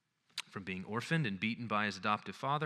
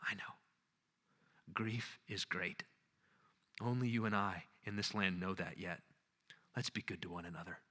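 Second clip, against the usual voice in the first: gentle, grieving Aslan; 4 semitones lower, 9 dB quieter than usual. A narrator's reading. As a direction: quietly share the grief. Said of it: "said Aslan".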